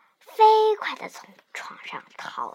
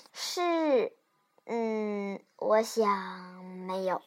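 low, soft, hesitant answer; halting, stammering; 8 semitones lower, 7 dB quieter, slower than usual. Acted. Child admits to mother not trying, haltingly. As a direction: falter between words, trailing off quietly. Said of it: "我结结巴巴地说".

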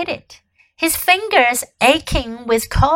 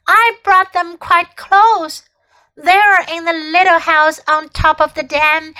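higher, anxious pleading; pleading boy; 6 semitones higher than usual. A narrator's reading. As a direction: beg the queen nervously.

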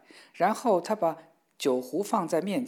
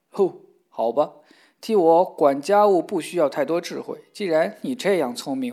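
resigned Hans; 7 dB louder than usual. Acted. Hans gives in amused.

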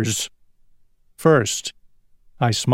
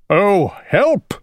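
put-on distressed old king's cry, pitched up; mild storybook cry of alarm; crying for help; 5 semitones higher than usual. A narrator's reading. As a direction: call out for help, higher, gently hammed. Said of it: "cried the king".

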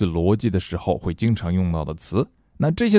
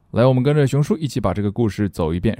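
normal talking voice

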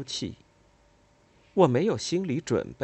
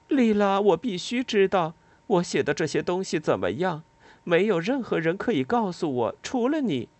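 impatient; 8 semitones higher than usual. A narrator's reading. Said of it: "我有点儿不耐烦了".

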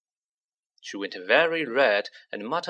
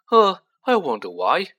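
sarcastic; 5 semitones higher, 8 dB louder than usual. A narrator's reading. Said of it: "said Ron sarcastically".

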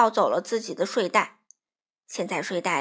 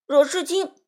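higher, louder insult fired back; sharp comeback, pitched up; snappy retort; 5 semitones higher, 5 dB louder than usual. A narrator's reading. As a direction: hit back at once with a name. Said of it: "珠宝马上回击".

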